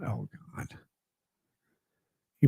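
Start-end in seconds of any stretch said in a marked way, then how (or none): 0.0-0.7 whispered dismay. Real, not acted. Reading his last chat, a whispered oh God. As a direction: breathe it out under your breath.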